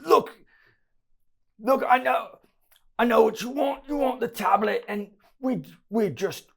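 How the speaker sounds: high, squealing street thug voice; panicked lowlife explaining himself; cornered thug pleading. Acted.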